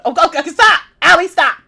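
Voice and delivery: high, loud flustered shouts; squealing at the flirting; flustered yelling. Real, not acted.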